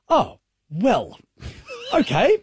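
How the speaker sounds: rising awkward okay; deflated okay. Retold or imitated. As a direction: shrug it off awkwardly. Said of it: "Like that".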